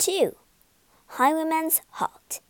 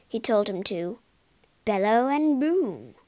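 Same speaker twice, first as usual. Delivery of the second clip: usual speaking voice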